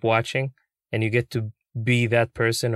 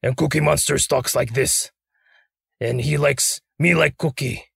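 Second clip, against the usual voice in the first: growly monster voice; Cookie Monster; 2 semitones higher than usual. Shown as a demonstration. Puppeteer shows the interviewer his character, growly monster voice. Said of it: "we have a growly type of character".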